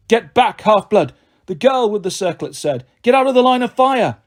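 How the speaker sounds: high, loud order; commanding shout. Acted. The huntress shouts at the girl charging the monster, loud and commanding. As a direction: bark it across the battlefield.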